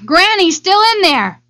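put-on high-pitched girl's outcry; frantic storybook girl; urgent cry. A narrator's reading. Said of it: "she cried".

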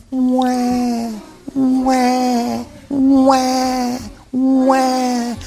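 put-on high wailing baby cry; baby wails in the knapsack, high crying; baby crying wah. A narrator's reading. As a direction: wail like an upset infant. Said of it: "she went".

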